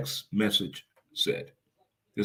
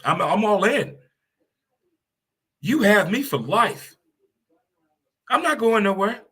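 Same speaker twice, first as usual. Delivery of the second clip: high, loud, fervent; fired up; 9 semitones higher, 5 dB louder than usual. Real, not acted. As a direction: declare it with fan passion. Fervent fan loyalty.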